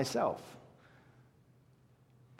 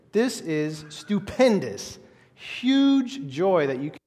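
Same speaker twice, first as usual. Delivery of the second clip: excited; 7 semitones higher, 7 dB louder, slower than usual. Real, not acted. Preacher building on huge joy.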